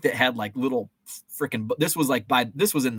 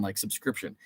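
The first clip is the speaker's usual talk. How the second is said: usual speaking voice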